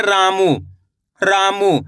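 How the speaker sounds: "रामू" with both vowels held long; stretched vowels. Shown as a demonstration. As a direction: draw out the रा and मू in "रामू". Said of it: "रा का उच्चारण खींच को रहा".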